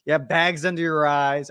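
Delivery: normal talking voice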